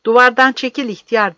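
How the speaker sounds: loud, high commanding yell; forceful captain's bellowed command; shouted order. Acted. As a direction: shout him away from danger. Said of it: "diye haykırdı kaptan".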